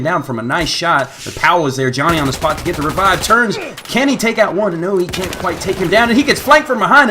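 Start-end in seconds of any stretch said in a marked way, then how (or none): 3.2-7.1 excited play-by-play. Real, not acted. Live play-by-play duel.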